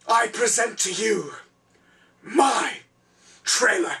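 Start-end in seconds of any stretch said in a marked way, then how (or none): none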